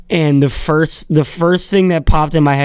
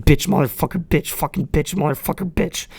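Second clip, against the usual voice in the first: cursing under his breath, rising toward the end; muttered cursing; 2 semitones higher, 6 dB quieter than usual. Retold or imitated. Pitcher mutters curses on the mound, imitated under his breath. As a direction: mutter swears to yourself before the pitch. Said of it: "he's like".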